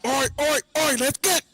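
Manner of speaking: high-pitched show-opening announcer voice; hype announcer. Acted. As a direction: announce it like a ringside hype man.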